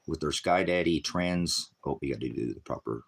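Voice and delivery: ordinary delivery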